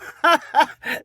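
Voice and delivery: scoffing burst of laughter; mocking laugh. A narrator's reading. Partner scoffing at Bryn's fishing boast, mocking laugh. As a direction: laugh it off in scorn.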